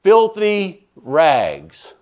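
very slow, drawn-out booming declaration; retold heavenly shout. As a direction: proclaim it from the sky, stretching each word. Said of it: "it shouted".

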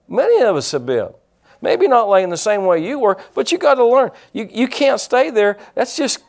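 revved-up sermon voice driving the point home; fiery preaching. Acted. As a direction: preach it hard to the congregation. Preaching at full force.

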